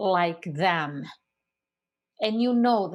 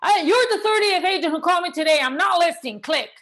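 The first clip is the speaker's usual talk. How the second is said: loud, fast, higher annoyed homeowner; 8 semitones higher, 6 dB louder, faster than usual. Retold or imitated. Fed-up seller snapping at the thirtieth agent, loud and fast. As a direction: snap it at the agent and hang up. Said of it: "without them going".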